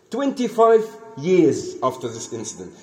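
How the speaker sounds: ordinary talk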